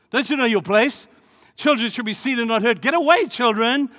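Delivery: high, loud brush-off; loud, haughty dismissal; arrogant scolding. Retold or imitated. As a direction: shoo the kids away with scorn. Arrogant disciples shoo children.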